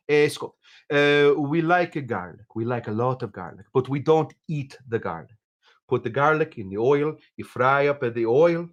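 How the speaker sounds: hammed-up Southern Italian cook; horrible Italian accent. Italian friends teaching him about garlic, mock Southern Italian accent. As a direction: explain it in a bad Italian accent. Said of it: "Horrible Italian accent, but they did sound a little bit like".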